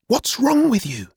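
put-on very high, indignant young voice; angry brother. A narrator's reading. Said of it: "Adam turned to me angrily".

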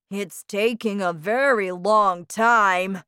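loud, complaining father voice; impatient father. A narrator's reading. Father waiting at the blank screen, loudly impatient. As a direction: grumble loudly about the wait.

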